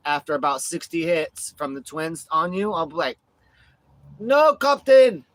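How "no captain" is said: high, drawn-out Scottish shout; Scottish accent. Pretend. Pissed partner on the sidelines, shouted Scottish accent. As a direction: cry out in hammed-up Scottish outrage. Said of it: "I'll be on the sidelines pissed".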